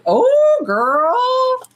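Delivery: pure disbelieving sass. Real, not acted. Reacts to message again.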